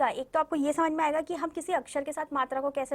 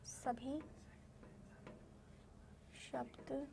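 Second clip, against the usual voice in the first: soft, slow word-by-word dictation, near a whisper; teacher quietly dictating homework; 22 dB quieter, slower than usual. Shown as a demonstration. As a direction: dictate each word apart with long pauses, very softly.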